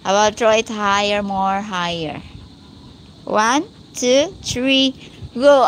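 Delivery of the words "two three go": usual speaking voice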